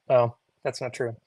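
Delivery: ordinary talk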